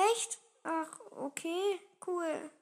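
soft, slow, half-awake reply; tired mumbled reaction; sleepy indifference. A narrator's reading. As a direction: answer with drowsy disinterest. Sleepy sister reacts without interest.

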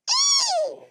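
very high, loud pony squeal; pony whinny. A narrator's reading. Pony answering the children, high whinny. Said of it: "sagte das Pony".

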